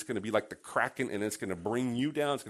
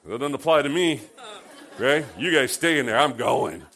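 smug rule-breaker; 2 semitones higher, 8 dB louder than usual. Retold or imitated. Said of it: "He's like".

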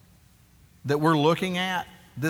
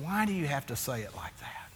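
whispered, much softer question; quiet rebuke; 9 dB quieter than usual. Retold or imitated. Wife whispering her rebuke to her husband. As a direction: hush it like a gentle rebuke.

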